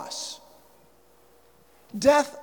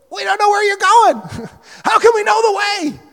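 confused disciple; 10 semitones higher, 9 dB louder than usual. Acted. Thomas asking, confused.

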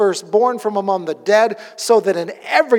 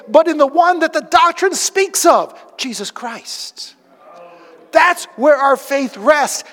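fervent preaching; 6 semitones higher, 4 dB louder than usual. Real, not acted. Preaching climax, raised and loud.

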